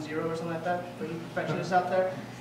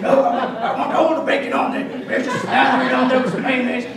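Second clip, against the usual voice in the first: louder, laughing demand; loud laughing insistence; 5 semitones higher, 11 dB louder than usual. Retold or imitated. Customer to the sandwich lady, loud laughing insistence. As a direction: pile it on, cracking up.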